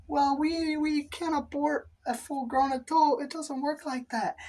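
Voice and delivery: high, prim receptionist voice; mocking high-pitched clinic staffer; high clinic worker voice. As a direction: squeak it out like a prissy clinic worker.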